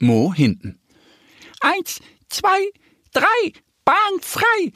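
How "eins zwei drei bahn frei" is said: very high little duck voice calling the start; Annie calling out. A narrator's reading. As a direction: sing out the countdown like a tiny duck. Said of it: "ruft Annie". (hohe Entenstimme, ruft den Start)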